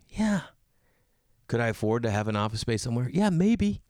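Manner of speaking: ordinary delivery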